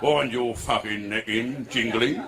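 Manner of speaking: slow, low sergeant-major growl; RSM policeman. Acted. Policeman silences the Indian bather, low sergeant-major voice. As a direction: tell him off like a parade-ground sergeant major. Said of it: "Used to be an R S M".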